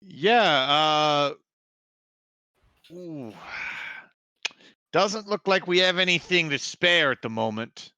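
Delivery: put-on a wary old villager; village quartermaster.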